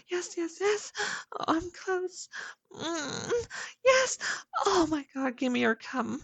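high, loud, gasping cries; climax cries. Acted. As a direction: cry out at the peak.

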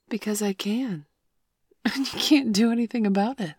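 loud playful laughing tease; teasing laugh. Acted. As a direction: cackle with mischief. Teasing laughter about edging.

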